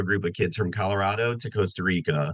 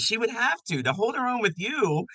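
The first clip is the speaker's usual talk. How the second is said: knowing sister; 8 semitones higher than usual. Retold or imitated. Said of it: "She goes".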